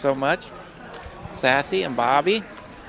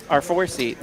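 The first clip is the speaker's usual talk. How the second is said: ordinary talk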